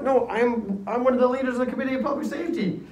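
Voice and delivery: high, rushed acted protest; indignant protest. Danton protesting arrest to police, indignant and rushed.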